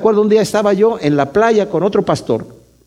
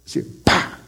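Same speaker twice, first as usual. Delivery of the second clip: slap sound; 7 dB quieter than usual. Retold or imitated. Acting out the slap.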